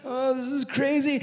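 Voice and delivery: high, hushed mock panic; anxious brothers' whine; freaked out. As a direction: fret under your breath in acted dread. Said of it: "the brothers are freaked out".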